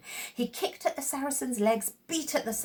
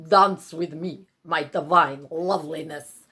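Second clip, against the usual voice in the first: roaring Saracen; 5 semitones lower, 6 dB louder than usual. Acted. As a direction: boom it out like a lumbering brute. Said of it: "roared the Saracen".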